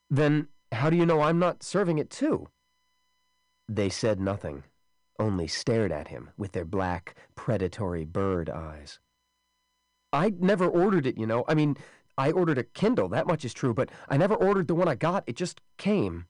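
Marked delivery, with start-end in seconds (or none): 0.1-2.5 high, loud defiant question; defiant challenge. Acted. 10.1-16.3 high, loud insistent protest; agitated protest. Acted. Wesley protests innocence.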